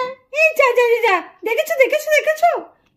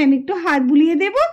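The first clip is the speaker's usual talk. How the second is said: tender reassurance; 7 semitones lower than usual. Acted. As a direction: soothe him like a small child. Aunt soothing the hurt boy. (নরম গলায় আশ্বাস)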